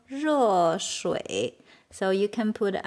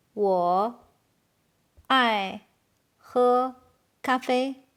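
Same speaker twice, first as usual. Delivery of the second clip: each word alone, long pauses between; 3 dB louder, slower than usual. Shown as a demonstration. Teacher translating "I love to drink coffee", halting after each word. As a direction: isolate every word for learners. Slow model, then natural take.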